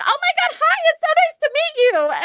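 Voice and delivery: imitating an overexcited high-pitched welcome; gushing greeting. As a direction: gush over meeting someone new.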